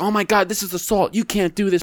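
high, shocked play-victim voice; a high mock cry of assault; mock outraged victim. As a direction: act scandalised and wronged. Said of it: "she's like".

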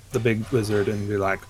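ordinary talk, nothing marked

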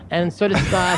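ordinary delivery

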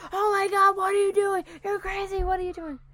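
high, loud mock outburst of alarm; frantic high-pitched scolding, easing off in volume; imitated screaming teacher. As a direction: imitate a shocked teacher yelling. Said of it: "we didn't expect him to scream like that".

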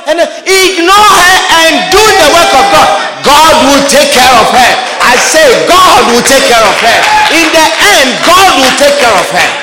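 a pastor pounding home his point; fiery preaching. Acted. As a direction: preach it hard to the congregation. Preaching at full force.

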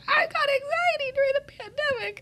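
high, mocking singsong imitation; mocking others' pandemic complaints in a high whiny voice. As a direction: parody people who complain.

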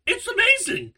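high, loud burst of excitement; excited exclaim. Real, not acted. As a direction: shout it out in delight. Gushing about the anime.

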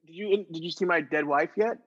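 normal talking voice

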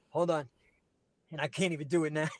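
usual speaking voice

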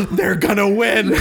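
high, laughing "they're gonna win"; remembered thrill, voice up high; giddy relief. Retold or imitated. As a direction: gasp and laugh it out in joy. Reliving the Mjolnir moment.

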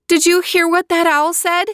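put-on high, pleading young girl voice; high young sister pleading; girl calling out. A narrator's reading. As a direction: call to your brother through the wall. Natalia voiced.

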